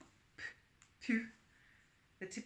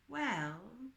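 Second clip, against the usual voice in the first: slowly; 5 semitones higher, 4 dB louder than usual. A narrator's reading. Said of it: "he said slowly".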